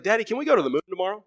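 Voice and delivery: put-on fast, high, eager little girl voice; a small child begging her dad, excited; five-year-old daughter. Daughter asking her daddy for a moon trip, high and eager. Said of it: "Penelope asked me one day".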